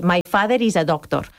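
"My father is a doctor" in Spanish accent, pitched low and level; "My father is a doctor" with Spanish r's and flat tune. Shown as a demonstration. Teacher demos a Spanish speaker saying "My father is a doctor" in Spanish accent. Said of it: "y nosotros diríamos".

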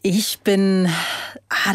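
normal talking voice

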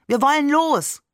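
put-on a kid tugging to leave now; impatient child. A narrator's reading. Boy tells his father, impatient to go.

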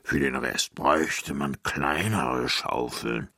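low, slow giant voice; ponderous giant thinking aloud; pondering giant. A narrator's reading. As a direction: muse deep and unhurried. Giant ponders his big hands.

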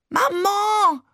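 very high, drawn-out cry for mother; yelling. A narrator's reading. Child yelling for mother carried off by the crowd, high yell. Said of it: "brüllte ich".

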